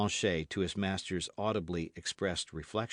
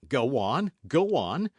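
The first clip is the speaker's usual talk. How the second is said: loud, higher urging; impatient urging; 7 semitones higher, 8 dB louder, slower than usual. A narrator's reading. D'Artagnan urging the host on, impatient. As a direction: hurry him along.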